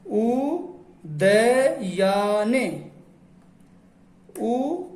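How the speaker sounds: उदयाने stretched very slowly, syllable by syllable; slow sounding out. Shown as a demonstration. Teacher sounding out उदयाने for the class, very slowly. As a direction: drag out उदयाने so every sound is heard. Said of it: "teacher sounds out a word".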